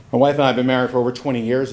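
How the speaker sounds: ordinary talk, nothing marked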